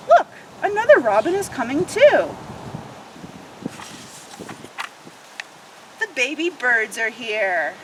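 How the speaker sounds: lively picture book read-aloud; expressive children's story narration. Acted. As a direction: read it like storytime for little ones.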